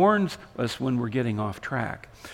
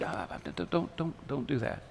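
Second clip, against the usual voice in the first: hushed, hesitant warning; Holy Spirit; 11 dB quieter than usual. Acted. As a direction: drop right down to imitate a gentle inner warning. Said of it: "the Holy Spirit whispered".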